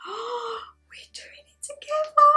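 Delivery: acted gasp into a high whispery squeal; gasp, then squeak it out in delight; thrilled gasp. Retold thrilled reaction with gasp.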